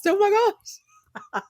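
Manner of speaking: overwhelmed, pitch shooting up; high drawn-out gasp. Real, not acted. A fan reeling from the fight scene, high and slow. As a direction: stretch "gosh" way up high and slow.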